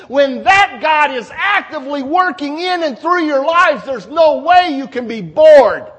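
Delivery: loud, driving sermon crescendo; forceful preaching. Real, not acted. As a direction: proclaim God's power with full force.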